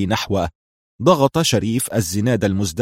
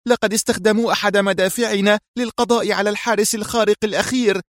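much higher, tense and rushed report; nervous tension; 11 semitones higher than usual. A narrator's reading. Mira reporting the lost team to her boss, high and tense. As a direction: blurt the bad news, close to panic. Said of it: "أدهشته نبرة العصبية في صوتها".

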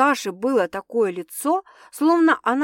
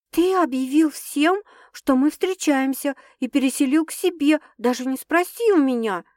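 exclaiming; 5 semitones higher than usual. A narrator's reading. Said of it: "воскликнула Саша".

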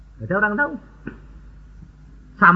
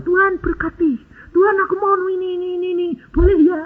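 mock prayer, higher and whining; whiny demanding prayer; 13 semitones higher, faster than usual. Believer demanding things from God in prayer, mock whine. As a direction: whine at God like a spoiled child.

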